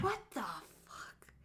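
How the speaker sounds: whispered what the fuck; shocked whisper. Retold or imitated. Reacting to a drunk who threw up, whispered shock. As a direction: breathe it out in disbelief. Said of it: "I was like".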